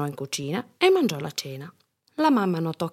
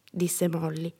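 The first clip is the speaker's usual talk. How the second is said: ordinary delivery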